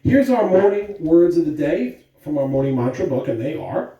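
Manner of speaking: bright, loud school PA announcement; announcer voice. Acted. Principal greeting the school on the morning announcements, loud announcer voice. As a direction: greet the whole school over the intercom.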